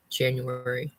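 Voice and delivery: normal talking voice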